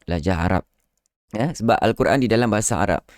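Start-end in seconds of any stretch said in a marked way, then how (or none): none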